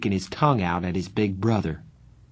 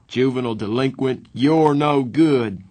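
loud, high mock shout; 4 semitones higher, 4 dB louder than usual. A narrator's reading. Darry shouts a mock insult at the boys. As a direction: shout it out like a cop scolding. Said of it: "Darry shouted".

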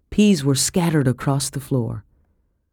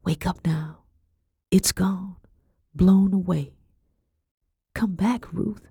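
soft, breath-only coaxing; hushed, soothing whisper; 3 semitones higher, 3 dB quieter than usual. A narrator's reading. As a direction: whisper tenderly to wake a child. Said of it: "I whispered".

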